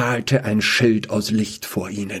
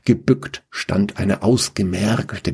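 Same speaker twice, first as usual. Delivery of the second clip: usual speaking voice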